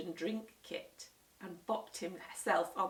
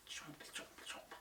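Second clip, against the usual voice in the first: whispered chomping; 17 dB quieter than usual. Acted. Eating noises.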